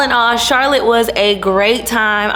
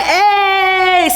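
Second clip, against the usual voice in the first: high shouted hey, laughing; joining the cheer; 11 semitones higher than usual. Retold or imitated. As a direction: yell it out with the crowd.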